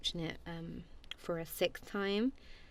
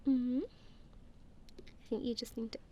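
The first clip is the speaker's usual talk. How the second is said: ordinary delivery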